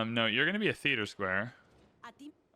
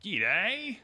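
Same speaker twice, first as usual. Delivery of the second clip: put-on Australian accent; 7 semitones higher than usual. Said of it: "That's how he would say it".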